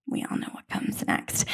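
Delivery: hushed whisper. Acted. Telling the listeners what comes next, whispered. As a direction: drop to a secret whisper. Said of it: "hushed aside".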